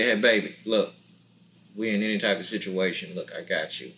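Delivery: deep, soft, hushed reassurance; low reassuring promise. Retold or imitated. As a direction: quietly assure her you've got her.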